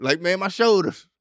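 usual speaking voice

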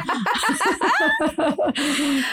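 a high, bright burst of laughter. Real, not acted. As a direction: laugh at your own joke. Laughing at her own story.

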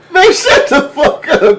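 high, stretched-out incredulous outburst; laughing disbelief. Real, not acted. Host reacting to friend's wild story, laughing disbelief.

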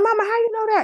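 very high-pitched mock disbelief; imitating own baffled, high-voiced reaction; incredulous. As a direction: squeal the question at mama. Child questioning mother in story.

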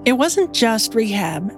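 usual speaking voice

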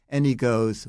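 usual speaking voice

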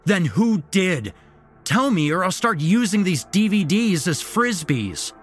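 high, loud furious demand; angry threat. A narrator's reading. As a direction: threaten him, shouting.

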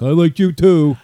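put-on fan cheer; mimicked fan. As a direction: gush it like an eager fan, mockingly. Mimicking fans at merch table.

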